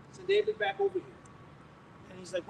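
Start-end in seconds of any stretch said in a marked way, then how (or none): 0.1-1.2 calling out high. Retold or imitated. Aunt calling for David.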